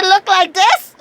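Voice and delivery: very high, loud crying-out disbelief; laughing outrage at the ugly building; incredulous shout. Retold or imitated. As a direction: shriek it like the building insults you. Mocking the ugly headquarters.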